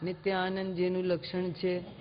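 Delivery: low, soft, lifeless rattle; dull rote reading. Shown as a demonstration. Teacher mocks a lifeless, rote delivery. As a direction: mumble it like a rote line. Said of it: "ऐसे नहीं बोलना".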